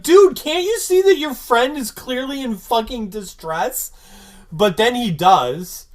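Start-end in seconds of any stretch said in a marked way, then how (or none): 0.0-3.9 loud, high-pitched indignant disbelief; exasperated outburst. Retold or imitated. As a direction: scold him as if he is missing the obvious. Exasperated imagined retort to Xander.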